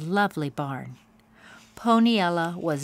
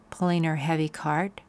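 ordinary delivery, nothing marked

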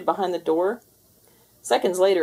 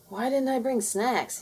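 hungry whine; 6 semitones higher than usual. Acted. Hungry cat complaining.

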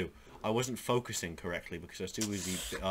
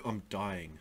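usual speaking voice

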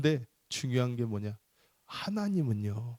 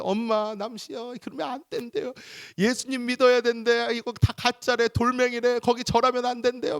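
put-on childish tattling; 11 semitones higher than usual. Friend reporting to mother.

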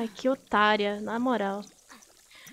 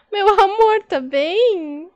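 high, loud cooing through laughter; laughing fond concern; 7 semitones higher, 10 dB louder, much slower than usual. Real, not acted. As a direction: coo to a pet that just tumbled. Acode o gatinho que caiu, rindo. (preocupação carinhosa, rindo, voz aguda)

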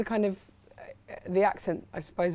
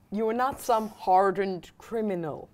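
put-on Philadelphia accent; 2 semitones higher, 8 dB louder, slower than usual. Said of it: "You've mastered Philadelphia Man".

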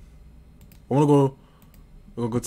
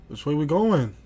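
anxious question; 5 semitones higher, 4 dB louder than usual. Real, not acted. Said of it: "I'm panicking".

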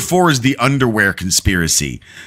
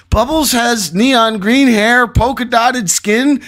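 much higher, nervous prank caller; panicked caller voice; 12 semitones higher, slower than usual. Acted. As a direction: play a frantic caller. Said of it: "I'm supposed to be in a panicked voice".

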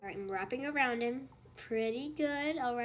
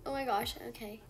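normal talking voice

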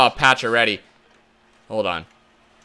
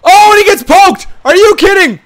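much higher and louder, disbelieving; stunned outcry; 7 dB louder than usual. Real, not acted. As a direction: cry out in real shock at the poke check. His shot gets poked away.

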